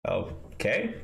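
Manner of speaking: ordinary talk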